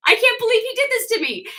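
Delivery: high-pitched, hurt whine; mock tearful. Wife abandoned at the party, mock tearful. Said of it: "I felt like crying".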